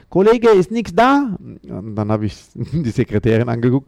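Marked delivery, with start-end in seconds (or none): 0.1-1.3 much higher, louder broken foreigner-talk German; mocking the club boss in exaggerated simple German; condescending boss. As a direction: talk down to him in pidgin German, pitched way up. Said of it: "Und dann sagt er".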